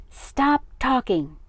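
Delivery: high, loud, slow angry order; bellow. A narrator's reading. Father cutting his son off, bellowing. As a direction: bellow each word heavily. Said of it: "bellowed his father".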